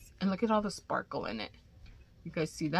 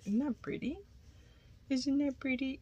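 cooing; 8 semitones higher, 5 dB quieter than usual. Real, not acted. Cooing over charm.

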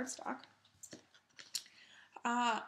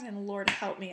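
ordinary talk, nothing marked

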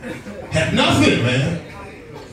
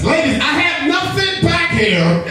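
high-pitched exasperated plea; pleading, pitched way up; mock protest; 12 semitones higher than usual. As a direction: complain in bafflement to grabby women. Mock protest to women grabbing him.

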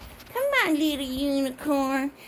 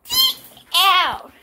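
louder, higher mock order to leave; mock command; 4 semitones higher, 7 dB louder than usual. Repeating what Hannah said, mock loud order. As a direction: imitate someone ordering others out.

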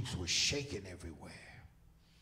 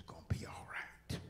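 whisper; 3 dB quieter than usual. Retold or imitated. Wife comforting him.

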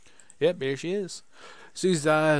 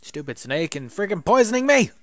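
higher, louder real annoyance at the game; frustrated outburst; 6 semitones higher, 9 dB louder than usual. Real, not acted. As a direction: yell at the snake that poisoned you. Poisoned by snake in game.

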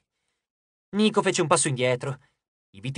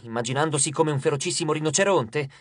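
usual speaking voice

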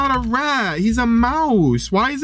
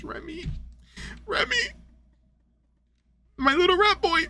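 high, soft little squeal; tearful-cute cooing; 10 semitones higher, 7 dB quieter than usual. Real, not acted. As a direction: coo softly, overcome at seeing a lost pet. Sees the lost pet again.

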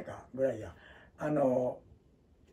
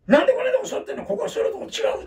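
high, loud, fast mock scolding; scolding auntie; 7 dB louder, faster than usual. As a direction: snap at him like a cross old lady. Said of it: "って怒られたもんね".

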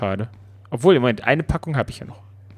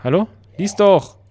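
louder, higher impatient push; impatient call; 6 semitones higher, 4 dB louder than usual. Real, not acted. Player impatient with the game, raised demand. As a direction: snap at the game to hurry. Urging game to show text.

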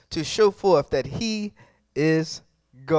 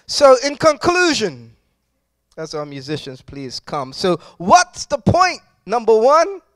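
loud, driving sermon voice, hitting each phrase hard; preaching at full force; 7 dB louder than usual. Acted. Pastor exhorting the congregation on God's power, loud full-force preaching. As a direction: preach it out with rising fire.

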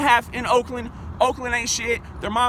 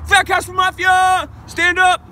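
loud slow crew shout-out, pitched up; shout-out yell; 6 semitones higher, 6 dB louder, slower than usual. Acted. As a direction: yell the crew name like a rallying cry.

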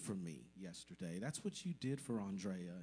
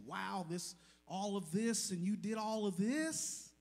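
amazed family; 10 semitones higher, 5 dB louder than usual. Retold or imitated. They said.

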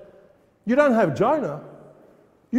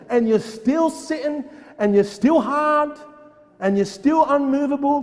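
rising rebuke; 6 semitones higher than usual. Real, not acted. Preacher rebuking hard hearts.

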